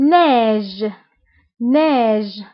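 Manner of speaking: ناج said as French neige; teacher modelling ناج as French neige; French word model. Shown as a demonstration. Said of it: "ليكزامبل الثاني".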